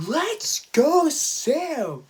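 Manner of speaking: loud, high fan yell; cheering. Retold or imitated. Fan cheering Sam as she turns aggressive, high excited shout. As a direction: yell it out high to spur the fighter on. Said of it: "I was cheering for Sam".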